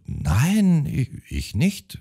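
a hint of a nervous elderly sister denying; mildly high, hesitant old woman; flustered old sister. A narrator's reading. Other sister answers, voiced.

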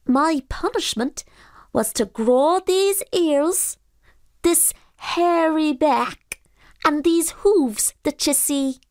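high, slow, loud creature voice; pooka voice. Acted. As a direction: drawl out your confession sadly. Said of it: "replied the pooka".

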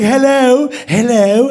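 mock British phone answer, rising; high, puzzled British "hello"; British accent. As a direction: imitate a confused Brit on the phone. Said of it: "British, I knew it".

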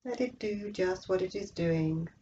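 usual speaking voice

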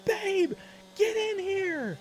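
very high excited yell, drawn out; reenacted shriek of a thrilled dad; literal scream. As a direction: call the wife in at a falsetto scream. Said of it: "I literally screamed".

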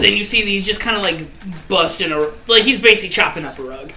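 ordinary delivery

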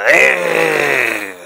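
a rolling R growled like a fierce beast. Shown as a demonstration. Teacher growling the R like a fierce beast for children. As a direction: growl the R like a wild animal. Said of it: "comme une bête féroce".

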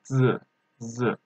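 short "z" with the tongue set as for "the"; pronunciation demo. Shown as a demonstration. Teacher shows learners the sound, careful short "z". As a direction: say a brief buzzed "z" syllable. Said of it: "but without saying the, you should say".

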